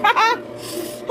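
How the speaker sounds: high, breaking cackle; laughing. Real, not acted. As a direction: burst out in a high-pitched laugh. Laughs at own story.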